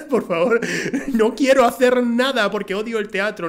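mock exasperated refusal; exasperated plea. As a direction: beg them to leave you alone.

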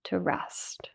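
breathy whispered word. Acted. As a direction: let it dissolve into a whisper.

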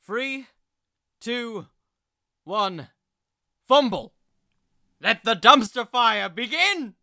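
countdown building to a loud launch shout; hype countdown shout. Acted. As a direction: kick off the segment like a game show host. Podcast segment countdown and launch.

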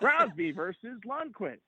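slow, high, swooping matchup billing; hammed-up broadcast hype; announcer impression. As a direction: stretch the names like a TV promo. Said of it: "That was a good impression".

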